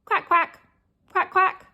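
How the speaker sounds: high, short duck quacks; playful duck imitation; duck quacking. Shown as a demonstration. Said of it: "Can you quack like a duck?".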